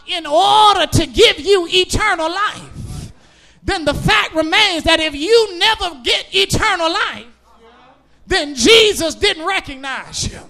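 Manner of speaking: loud, rhythmic whooping preacher cadence; preaching at full force. Acted. Preacher to his congregation, preaching at full force. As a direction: drive each line home to the pews.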